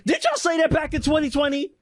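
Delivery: indignant demand, pitched high; indignant outcry. Real, not acted. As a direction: call them out sharply. Indignant question to Democrats.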